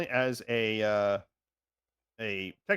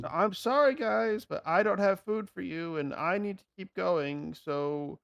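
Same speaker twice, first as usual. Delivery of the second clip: high-pitched placating apology; apologetic, in character; 8 semitones higher, slower than usual. Acted. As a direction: let the hungry pack down gently in a high voice. Player apologises in character to wolves.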